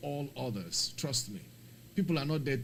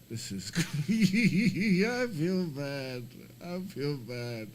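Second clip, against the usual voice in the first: laughing; 5 semitones higher, 7 dB louder, much slower than usual. Real, not acted. Embarrassed after a flight mishap.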